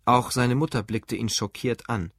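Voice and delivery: ordinary delivery, nothing marked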